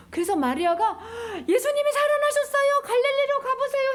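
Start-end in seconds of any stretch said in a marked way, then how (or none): 1.5-3.9 high, breathless good news; acted eager messenger, loud and high-pitched; excited announcement. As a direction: burst out with astonishing news. Mary announces the resurrection excitedly.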